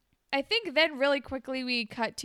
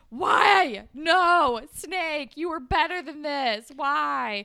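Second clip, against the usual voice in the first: loud mock wail, high and far off pitch; hammed-up wounded shout; anguished outcry; 5 semitones higher, 8 dB louder, much slower than usual. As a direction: cry out in exaggerated despair at Snake.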